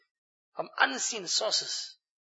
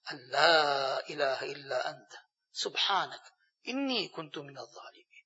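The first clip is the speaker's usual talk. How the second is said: dua recitation; 5 semitones lower, 7 dB quieter than usual. Shown as a demonstration.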